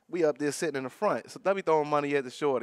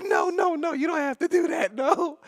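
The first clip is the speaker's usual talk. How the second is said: laughing high protest; 13 semitones higher than usual. Retold or imitated. Jake refusing the dancer, high laughing shout. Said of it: "He was like".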